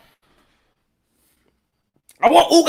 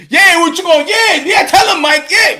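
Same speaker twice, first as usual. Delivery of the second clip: hype man; 6 semitones higher, 6 dB louder than usual. Retold or imitated. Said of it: "You be his boy and be like".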